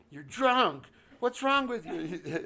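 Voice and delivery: put-on narrator, annoyed, tells off his drunk cousin; mock annoyed retort.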